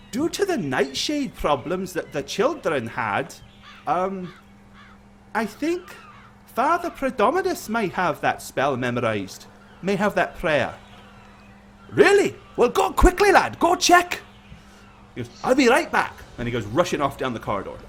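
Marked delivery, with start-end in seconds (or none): 0.1-10.7 put-on high, slow, hesitant young cleric; young priest. Young priest suggesting another father's prayer, hesitant. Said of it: "young priest says". 11.9-14.2 high, loud, eager command; urgent excitement. Acted. Priest sending the lad to check, urgent. 15.4-16.1 high, hurried call; young priest rushing. Acted. Young priest leaving to check, hurried and eager.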